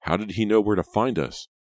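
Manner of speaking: a mildly alarmed character asking; puzzled, voice lifted; bewildered. Acted. Bewildered character asks about rescuer.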